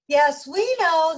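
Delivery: high, loud and slow chorus shout; shouting back. Acted. The donuts shout back to the baker, loud slow shout. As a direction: call it back cheerfully. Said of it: "the donuts shouted back".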